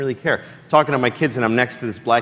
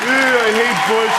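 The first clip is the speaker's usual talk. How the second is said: higher, louder mock disgust; disgusted sneer; 8 semitones higher, 4 dB louder than usual. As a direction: sneer like a smug teen.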